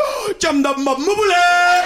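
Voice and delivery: high, slow, loud mock gibberish greeting; mock African greeting. Rwandans greeting the arrival at the airport, high slow gibberish. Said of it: "and went".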